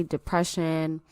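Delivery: ordinary delivery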